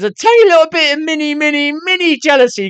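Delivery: high, stretched-out little voice; playfully tiny. Real, not acted. Admitting to a tiny bit of jealousy, playfully high-pitched. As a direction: squeeze it up high to make it tiny.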